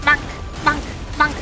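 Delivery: ordinary delivery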